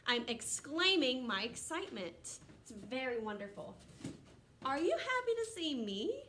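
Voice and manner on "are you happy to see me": higher with a rising question tune. Shown as a demonstration. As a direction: ask it, lifting at the end. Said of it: "That's a question".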